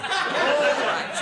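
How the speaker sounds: wife grudgingly agrees to the horse. Retold or imitated. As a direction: give a sniffy all right. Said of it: "looking down at that angle".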